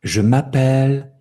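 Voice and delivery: "Je m'appelle" with "pelle" held high and long; lengthened last syllable. Shown as a demonstration. Teacher demonstrating French accent, final syllable lengthened. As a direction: raise and stretch the end of "m'appelle". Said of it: "C'est plus long".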